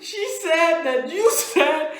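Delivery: high, loud, through laughter; laughing accusation. Retold or imitated. Teller repeating the accusation, laughing loudly. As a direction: protest while cracking up. Repeating punchline while cracking up.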